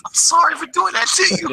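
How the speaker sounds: high-pitched, hammed-up pleading; mock apology. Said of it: "I'd have to apologize".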